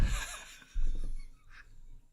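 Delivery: hushed, airy laugh; whispered laugh. Real, not acted. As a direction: breath-only giggle. Laughs while whispering on ASMR stream.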